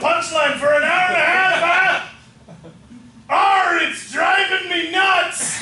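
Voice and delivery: slow loud pirate growl; pirate captain shout. Acted. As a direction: bellow it like a pirate.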